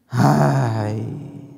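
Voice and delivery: normal talking voice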